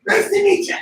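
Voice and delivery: put-on very high, buzzing, laughing baby; hyper baby voice. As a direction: play a wired newborn. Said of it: "Amanda's gonna come out".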